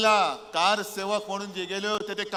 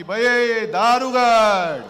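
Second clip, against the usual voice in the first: slow, loud drawn-out cry to the goddess; ringing invocation; 2 semitones higher, 8 dB louder, much slower than usual. Acted. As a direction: call out slowly and powerfully, like a ritual plea. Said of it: "तिला मी आज साथ घालतोय की".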